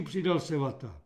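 ordinary delivery, nothing marked

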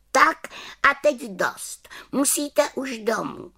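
high witch voice; little witch voice. Acted. Little witch sends the children home, high voice. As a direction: tell them firmly it's time.

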